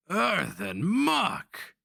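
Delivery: slow, high, loud roar. A narrator's reading. Barbarian roars at the sorcerer with a carnivorous grin, slow roar. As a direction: draw the oath out in a savage roar. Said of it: "he roared on a carnivorous grin".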